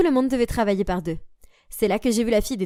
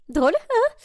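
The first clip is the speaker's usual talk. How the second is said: much higher, startled echo; surprised; 10 semitones higher, slower than usual. Acted. Girl surprised at being called funny, higher. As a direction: squeak it back in surprise.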